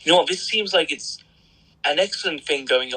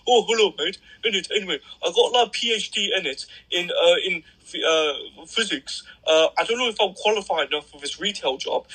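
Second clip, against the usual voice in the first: higher-pitched mock Brummie job applicant; Birmingham accent; 4 semitones higher than usual. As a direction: play a jobseeker with a Birmingham accent. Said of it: "I'm from fucking Birmingham".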